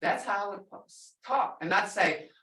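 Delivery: usual speaking voice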